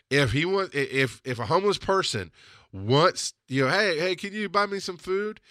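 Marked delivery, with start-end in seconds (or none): 3.7-5.4 lightly voiced, higher-pitched request; imitated plea. Homeless person asking him to buy food, imitated plea. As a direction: imitate someone asking a favor.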